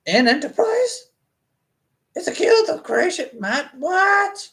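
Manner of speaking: very high, incredulous squeal; mock disbelief. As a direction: shoot the pitch way up in baffled questions. Mock disbelief at the guild's name.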